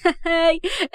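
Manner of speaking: high acted giggle; friend's laugh. A narrator's reading. Friend laughing along on the phone, high giggle. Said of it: "أسماء كمان فضلت تضحك".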